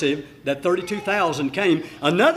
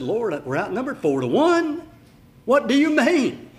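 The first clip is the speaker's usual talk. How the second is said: incredulous protest; 6 semitones higher, 4 dB louder than usual. Retold or imitated. Preacher voices doubting Gideon.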